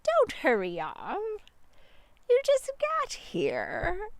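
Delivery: slow, teasing wolf voice; wolf cornering the sheep; sly wolf. Acted. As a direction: purr it like a wolf with dinner in sight.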